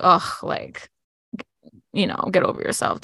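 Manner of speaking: breathy dismissive scoff; scoffing mock dismissal. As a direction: sneer it without voice. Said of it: "they're like".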